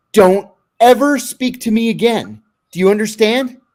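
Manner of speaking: stern ultimatum, stressed hard; retold angry warning. Acted angry warning to the inmate.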